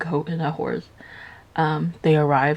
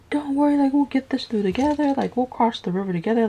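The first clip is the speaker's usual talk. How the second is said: higher-pitched, lightly acted coaxing; mock reassurance; 8 semitones higher, faster than usual. Sneaky rat promises to cross the river together, mock reassuring. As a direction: lightly play the sneaky rat winning someone over. Sly rat sweet-talks the cat.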